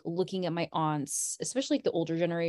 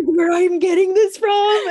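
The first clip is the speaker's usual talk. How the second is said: loud, high, drawn-out exclamation; exclaiming realization; 13 semitones higher, 13 dB louder, slower than usual. Retold or imitated. Daughter retelling her reaction to mom, loud exclaiming. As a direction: blurt it out loudly in amazed recognition.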